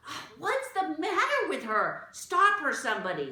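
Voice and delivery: high, loud frantic call; alarmed outcry. Acted. Catherine calls for someone to stop Jane, alarmed. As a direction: cry out for someone to stop her.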